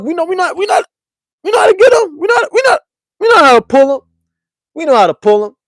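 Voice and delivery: excited brag about catching women; hyped boasting. Real, not acted. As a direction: hype yourself up, cracking into a yell.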